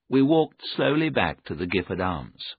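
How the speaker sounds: ordinary delivery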